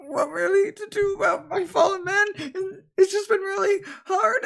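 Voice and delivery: put-on high, sobbing voice of a frightened young man; crying panic. Young villager sobbing to the old woman about his lost men. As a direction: blubber it out, voice cracking.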